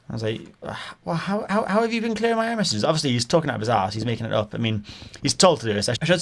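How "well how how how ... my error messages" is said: high-pitched mock incredulity; acted bafflement. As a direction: stammer into a high, puzzled question. Acts out his puzzled challenge to caller.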